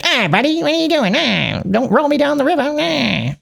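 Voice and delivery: very high squeaky little-guy voice; munchkin voice. Retold or imitated. A munchkin begging not to be rolled into the river, very high squeak. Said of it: "The munchkin's like".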